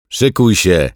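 loud, high shout; shouted warning. A narrator's reading. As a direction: yell it as an order to brace. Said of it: "krzyknął". (głośny, wysoki okrzyk)

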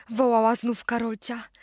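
ordinary delivery

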